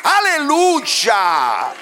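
high, loud preacher's shout; fiery revival preaching; preaching at full force. Acted. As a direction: proclaim it to the congregation.

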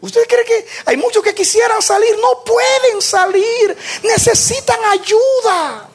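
high, loud shouted appeal; fiery preacher's cry; preaching shout. Acted. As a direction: shout the question to the congregation.

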